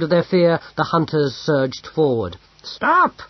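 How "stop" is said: sharp high shout. A narrator's reading. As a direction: stop the hunters with one loud cry. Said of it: "shouted Pismire".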